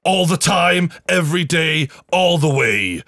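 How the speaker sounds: loud ad-slogan delivery; slogan shout. Acted. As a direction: belt it like a jingle. Mock slogan chant for sponsor.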